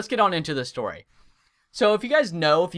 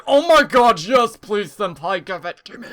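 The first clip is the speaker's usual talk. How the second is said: loud, high, hammed-up joyful sobbing; mock happy crying; 6 semitones higher, 9 dB louder than usual. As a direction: imitate begging through tears of joy. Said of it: "she started crying with tears of joy".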